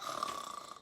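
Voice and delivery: long unvoiced out-breath after crying; breathy sigh of release. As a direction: let it all out in one exhale. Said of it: "when you have a big cry and you're like".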